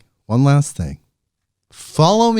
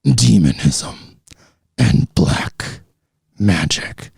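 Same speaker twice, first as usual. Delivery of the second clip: deeper, darker demon growl; 7 semitones lower, slower than usual. Pretend. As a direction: push the demon voice even lower and eerier. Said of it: "that was my demon voice".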